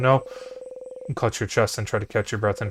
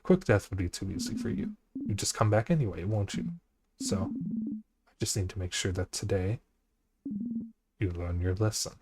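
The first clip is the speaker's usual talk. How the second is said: cold quiet threat; 7 dB quieter than usual. Acted. King threatens coldly.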